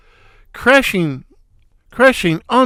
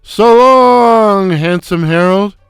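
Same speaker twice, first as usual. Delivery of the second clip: booming, much louder and higher farewell; foghorn voice; 3 semitones higher, 7 dB louder, slower than usual. Acted. Shemp bidding Harold goodbye from the doorway, foghorn booming. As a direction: blast it out like a foghorn. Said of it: "announce in his foghorn voice".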